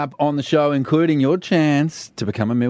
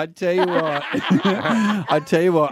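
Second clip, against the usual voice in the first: laughing; 4 semitones higher, slower than usual. Real, not acted. Laughing while talking.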